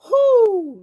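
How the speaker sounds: high, loud whoop of joy; excited whoo. Real, not acted. Host overwhelmed with joy, whooping high and loud. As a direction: let out a delighted whoo.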